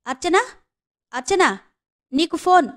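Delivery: high-pitched call across the office; calling out loudly. A narrator's reading. Operator calling a colleague to the phone, loud call. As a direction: call her name out across the room. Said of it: "అంటూ operator గట్టిగా పిలిచింది".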